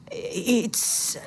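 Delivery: dry, faint catch in the throat; the word dies as his voice gives out. A narrator's reading. Frightened man facing the old woman, voice drying up. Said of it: "his voice dries in his throat".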